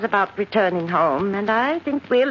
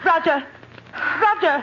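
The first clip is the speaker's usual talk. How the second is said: sharp call, higher each time; 9 semitones higher than usual. Acted. Martha summoning her nephew from another room, calling out. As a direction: call his name across the house.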